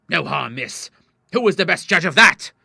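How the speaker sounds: indignant, building to a loud high finish; angry rebuke. A narrator's reading. Father rebukes pleading daughter, angrily. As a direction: challenge crossly, then raise the voice.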